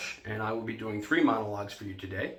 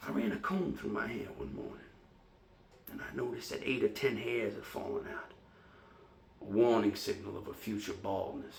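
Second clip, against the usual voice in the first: subdued, brooding confession with brief flares; troubled serviceman remembering his fears; haunted fading golden boy. Acted. Performs Sweet Bird of Youth monologue.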